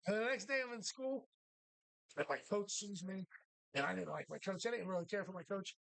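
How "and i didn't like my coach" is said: ordinary talk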